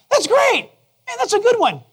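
high-pitched, enthusiastic coworker cheer; excited praise. Acted. Coworkers praising a colleague's good work, excited.

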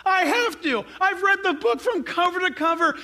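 high, impassioned insistence; urgent conviction. Acted. Acts an urgently convinced convert.

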